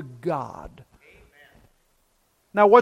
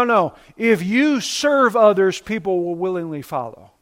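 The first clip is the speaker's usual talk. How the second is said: normal talking voice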